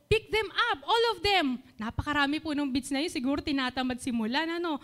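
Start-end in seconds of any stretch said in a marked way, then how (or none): 0.1-1.6 retold firm order, higher pitched; mildly raised, higher instructing voice; slightly raised voice. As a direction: tell a child to tidy up, firm but not angry. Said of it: "medyo lumakas din po yung boses ko ng konti".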